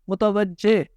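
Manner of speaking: متوجہ said as mu-ta-waj-je; changed ending. Shown as a demonstration. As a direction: end متوجہ on "je", not "jo". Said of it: "اور تبدیل ہو جاتا ہے". (متوجہ میں جیم کے نیچے زیر)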